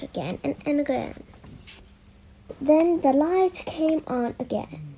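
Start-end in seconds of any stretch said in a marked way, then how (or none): none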